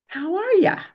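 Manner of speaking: high, delighted friendly greeting; beaming delight. Retold or imitated. Old man greeting the drunk, beaming and delighted. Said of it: "beaming with delight at this man".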